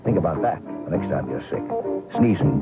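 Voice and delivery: ordinary delivery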